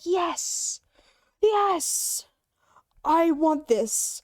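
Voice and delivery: high, drawn-out excited yes; mock excitement. Streamer recalling finding a voice changer, excited.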